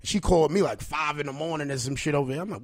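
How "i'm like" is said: ordinary talk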